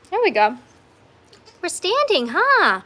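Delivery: high, cheering coo to a goat. Real, not acted. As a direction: cheer the little one on.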